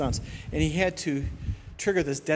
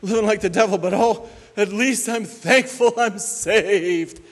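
mock counselee; 6 semitones higher, 6 dB louder than usual. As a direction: parody someone bragging they're saved. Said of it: "when they say".

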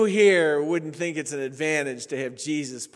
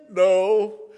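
louder, weepy clipped answer; mock tearful no; 7 dB louder than usual. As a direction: answer through fake tears. Weeper's sniffling answers.

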